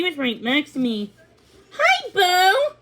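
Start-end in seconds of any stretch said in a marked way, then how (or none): 1.8-2.8 high, louder cheery horse voice; a high neighing hello; neighing greeting. A narrator's reading. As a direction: call it out bright and high like a young horse. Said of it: "she neighed".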